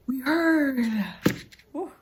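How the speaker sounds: high, loud mocking cry; mock wail. Real, not acted. Reader mocking the book's repetition, with a mock wail.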